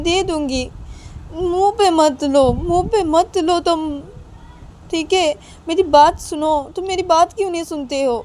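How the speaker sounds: high, loud, pleading mother; calm a wailing child, half scolding; coaxing crying child. Acted. (रोते बच्चे को ऊँची आवाज़ में मनाती माँ)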